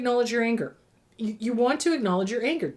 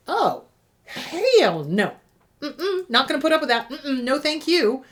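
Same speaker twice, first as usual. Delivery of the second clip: defiant refusal; 5 semitones higher, 3 dB louder than usual. Retold or imitated. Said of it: "when you go from fawning to".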